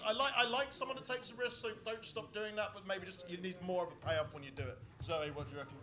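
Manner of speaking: ordinary talk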